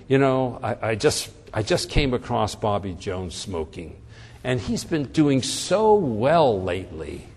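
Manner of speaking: low, hesitant teacher reporting a pupil; regretful tone of voice. Acted. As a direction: break it reluctantly, stretching "so well lately". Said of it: "my tone of voice".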